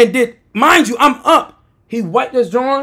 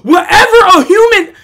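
high, loud exclamation through laughter; laughing outburst; 10 semitones higher, 7 dB louder than usual. Real, not acted. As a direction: burst out laughing mid-story. Cracks up at Thanos comparison.

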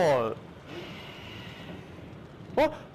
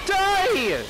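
angry shout; 8 semitones higher, 3 dB louder than usual. Real, not acted. Fighting monsters.

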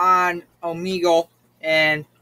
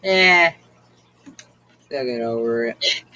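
ordinary talk

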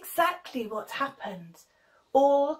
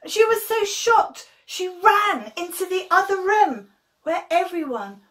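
excited narration; 7 semitones higher, 6 dB louder than usual. A narrator's reading.

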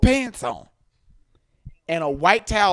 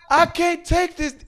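retold scream; 11 semitones higher, 7 dB louder than usual. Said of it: "he's screaming".

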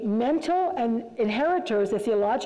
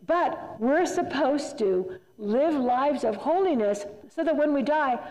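ordinary talk

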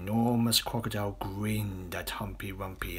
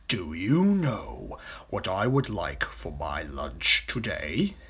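slow, savouring crocodile voice; hungry crocodile; slower than usual. Acted. Big crocodile asking his companion about lunch, slow and relishing. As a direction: draw it out like a scheming crocodile. Crocodile figure in story dialogue.

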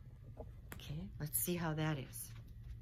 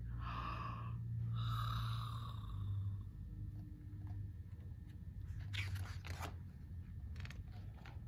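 long drawn gasp; 4 dB quieter than usual. A narrator's reading. Yawn or gasp in story.

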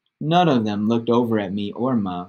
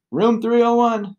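called out from offstage; from another room; 12 semitones higher, 4 dB louder than usual. A narrator's reading. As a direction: shout it from the next room. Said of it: "called out from the other room".

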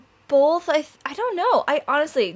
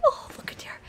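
hushed, adoring whisper; real soft awe; awed whisper; 10 dB quieter, faster than usual. Real, not acted. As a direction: whisper in wonder at a newborn. Awed by the baby dragon.

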